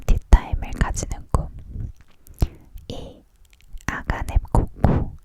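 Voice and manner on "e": ordinary delivery, nothing marked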